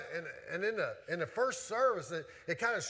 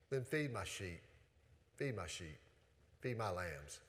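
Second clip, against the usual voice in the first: Jesus speaking low and much softer, tenderly; 10 semitones lower, 9 dB quieter than usual. Acted. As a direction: gently hand Peter the task, softer each time. Voicing Jesus to Peter.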